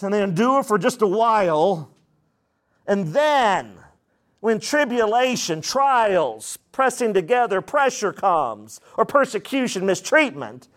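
ordinary talk

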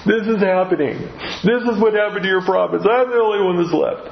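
high, mock-whiny complaint; whining Elijah. Preacher mocking Elijah's self-pity with a high whine. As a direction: whine it like a self-pitying prophet. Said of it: "Elijah's response is he whines".

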